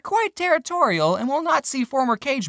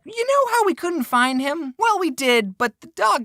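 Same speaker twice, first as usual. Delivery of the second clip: a higher, light imitation of mom; mom's voice; 9 semitones higher than usual. Mom telling son the dog got Buddy, higher and light. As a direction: lightly voice mom breaking the news. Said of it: "She said".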